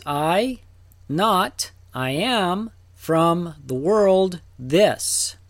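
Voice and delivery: each piece set apart, "I", "not", "I am", with pauses; literal word-by-word reading. Shown as a demonstration. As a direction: read it out piece by piece, slowing on "from the world". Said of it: "Literally, it says".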